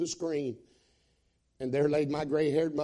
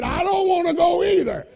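high, loud protesting yell; mock shout; 14 semitones higher, 10 dB louder than usual. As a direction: yell the complaint like the reluctant friend. Friend protests, voiced shouting.

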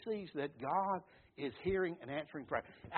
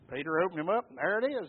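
ordinary talk

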